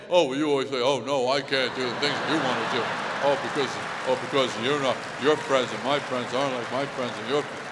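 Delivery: loud, low, dopey mock-man voice; woman imitating man. Wife mocking husband mid-argument, low imitation of his voice. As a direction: mimic him in a heavy low voice. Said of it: "imitate the voice of the man".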